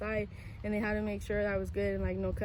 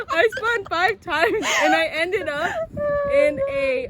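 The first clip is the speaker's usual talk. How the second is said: laughing; 10 semitones higher, 10 dB louder, slower than usual. Real, not acted. Still laughing through the spin.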